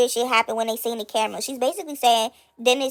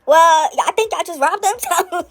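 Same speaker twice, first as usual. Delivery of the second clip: laughing; 3 semitones higher, 6 dB louder, slower than usual. Real, not acted. Laughs at the retold story.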